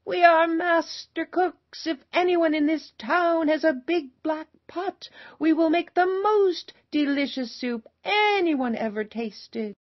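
high, loud storyteller's shout; proud shout. A narrator's reading. Two travelers announcing their cooking to the town, shouting. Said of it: "and shouted".

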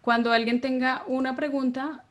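ordinary talk, nothing marked

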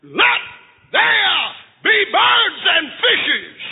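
high, ringing command, drawn out slowly; God's command shout. Acted. God calling out to nothing, a slow high command. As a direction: call creation into being, slow and high. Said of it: "he shouted to nothing".

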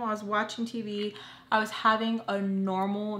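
ordinary talk

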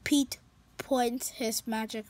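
usual speaking voice

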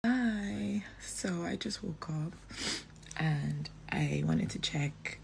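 soft hushed whisper. Real, not acted. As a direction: whisper so no one wakes. Whispering just after waking.

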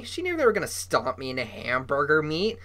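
usual speaking voice

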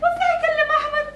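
high, tearful, rattled voice; shaken after nightmare. Acted. Wife telling cousin about the nightmare, high and rattled. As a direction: speak shaken and on edge.

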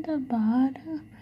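breathy whispered words. Real, not acted. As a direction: whisper it softly to yourself. Whispered while applying makeup.

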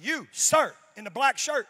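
single him out from the line; stern TSA agent calling across the line; authoritative agent. Retold or imitated. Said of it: "she's like".

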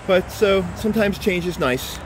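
normal talking voice

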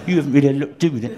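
smug, laughing sarcastic put-down, quick; laughing through a smug jibe; smug repeat. Shown as a demonstration. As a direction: say it again smugly to wind them up. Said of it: "I did it like that as well, quite annoying".

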